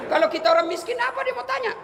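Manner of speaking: high-pitched, animated challenge to the listeners; high rhetorical question. Real, not acted. Preacher asks congregation what a poor man gets asked, high rhetorical question.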